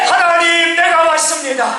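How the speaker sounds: high, pleading cry to God; wailing prayer. Acted. Hezekiah facing the wall praying to God, crying out. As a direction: call out to God over and over in full-throated prayer. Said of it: "통곡하며 기도하기를 시작합니다".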